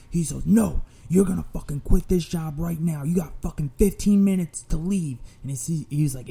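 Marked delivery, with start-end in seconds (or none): 0.4-5.2 raised, higher angry voice; acted-out threat, pressing each demand; angry ultimatum. Aggressor orders cashier to quit and leave, angry ultimatum.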